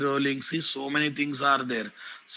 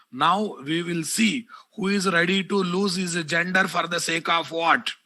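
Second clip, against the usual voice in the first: angry scolding; 4 semitones higher than usual. Real, not acted. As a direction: call him out angrily by name. Teacher scolding and calling out student.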